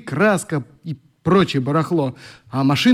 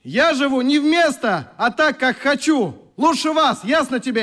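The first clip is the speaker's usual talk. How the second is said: higher, slow defiant shout; shouting through door; 9 semitones higher than usual. Acted. Oleg shouting at his sister behind the door, defiant. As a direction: yell it at the closed door. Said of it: "крикнул в закрытую дверь".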